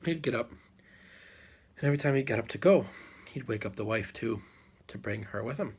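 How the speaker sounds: usual speaking voice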